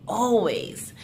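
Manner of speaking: whispered. Acted. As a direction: whisper the word always as an echo. Whispered repeat of the verse.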